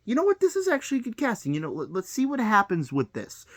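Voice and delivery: hammed-up cheerful fan impression; mocking fan voice. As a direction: parody a naive excited fan. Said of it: "people are like".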